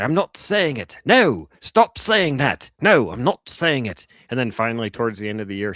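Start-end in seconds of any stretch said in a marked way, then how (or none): 0.0-4.1 high, loud mock-British refusal; exasperated British teacher. Imitating British teacher refusing her pestering student, high exasperated British accent. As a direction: protest in an indignant, high British voice. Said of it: "she was British".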